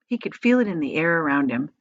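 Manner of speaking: ordinary delivery, nothing marked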